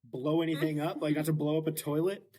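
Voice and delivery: usual speaking voice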